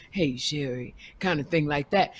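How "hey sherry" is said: a hint of a sexy purr on the greeting; mock sexy voice. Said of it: "a kind of sexy kind of".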